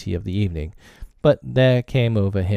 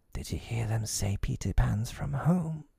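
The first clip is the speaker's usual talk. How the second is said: much softer, hushed question; whispered; 3 semitones higher, 8 dB quieter than usual. A narrator's reading. Smee to Hook near the hideout, whispering. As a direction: ask it under your breath. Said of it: "Smee whispered".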